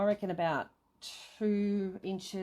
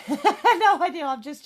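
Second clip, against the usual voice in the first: laughing; 9 semitones higher, 12 dB louder than usual. Real, not acted. Laughing about the needle.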